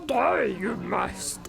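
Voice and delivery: low, slow creature voice; mock sage pronouncing doom, drawn out; Yoda. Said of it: "it's not fucking Yoda".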